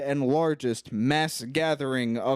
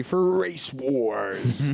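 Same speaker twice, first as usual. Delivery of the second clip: mock announcer drawing it out slowly; hyped announcer; much slower than usual. Co-host hyping the big race scene, drawn-out mock announcer. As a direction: draw out the event name like an announcer.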